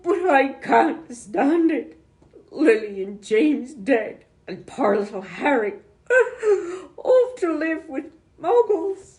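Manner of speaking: high, loud, slow wailing cry; grief-stricken giant crying; sobbing. A narrator's reading. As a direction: wail through the tears. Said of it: "sobbed Hagrid".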